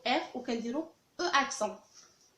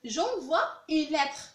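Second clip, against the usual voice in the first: slow, raised, careful French; slow model sentence; 4 semitones higher, 4 dB louder, much slower than usual. Shown as a demonstration. Teacher modelling the sentence for the class, slow and clear. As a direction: read it out slowly and clearly. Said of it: "teacher models French sentence".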